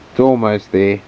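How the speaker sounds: ordinary talk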